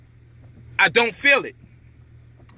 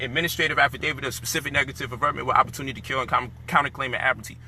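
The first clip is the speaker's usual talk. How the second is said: rattled-off legal terms; 7 semitones lower, 5 dB quieter, faster than usual. Retold or imitated. Retells his legal speech.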